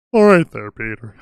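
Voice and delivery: a hint of the Tick actor's drawling hello; Patrick Warburton impression. Friend trying his Patrick Warburton voice, mock loud greeting. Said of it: "You sound like ... He plays the Tick".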